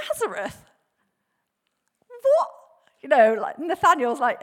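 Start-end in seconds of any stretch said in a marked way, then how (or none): 0.1-2.5 acted incredulous questions; stunned repeat, then a high blurt; shocked disbelief. Nathanael hearing where the Messiah is from, shocked disbelief. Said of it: "you can hear the kind of shock".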